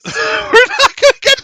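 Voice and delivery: high giggle through the words; laughing. Real, not acted. Game master echoing the player's joke, laughing.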